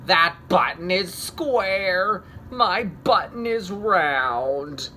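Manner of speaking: slow, loud wail. Acted. Toad wailing at raccoon's wrong button, wailing. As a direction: stretch it into a wail.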